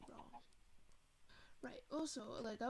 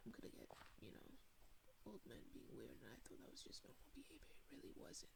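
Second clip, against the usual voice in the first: whispered, much softer confession; hushed aside; 2 semitones lower, 12 dB quieter, faster than usual. Real, not acted. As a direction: drop to a whisper as if sharing a secret. Confessing uncomfortable memory.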